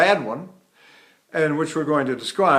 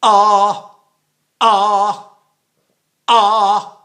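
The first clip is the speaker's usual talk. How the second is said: sharp hard glottal onsets, loud; loud sung ahs struck from a closed glottis; glottal stroke attacks; 4 semitones higher, 8 dB louder, much slower than usual. Shown as a demonstration.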